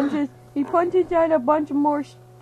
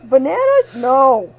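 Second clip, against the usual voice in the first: loud mock shout; 9 dB louder, slower than usual. Listing foods loudly.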